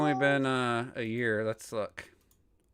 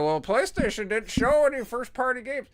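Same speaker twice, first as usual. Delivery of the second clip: higher-pitched mock complaint; mimicked complainers; 10 semitones higher than usual. Quoting what people said about the showcase, mimicked complainers. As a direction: put on the voice of griping fans. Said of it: "I heard a lot of".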